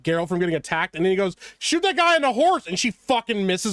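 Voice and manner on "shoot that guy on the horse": higher-pitched mock command; raised, urgent retelling of a command; urgent quoted order. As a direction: call out an order in imitation. Acts out character's urgent order.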